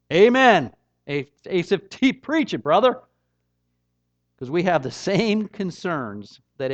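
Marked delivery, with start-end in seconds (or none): 0.1-3.0 a loud, cheering amen to the psalmist; mock amen corner. Preacher egging on Asaph like a congregant, loud and cheering. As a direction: shout him on like the back pew.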